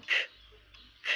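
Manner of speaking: ค as a short aspirated k puff; hard k sound. Shown as a demonstration. Teacher showing the first of two sounds, ค as hard k. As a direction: say ค as a bare hard k, no vowel. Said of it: "สามารถออกเสียงได้สองเสียง".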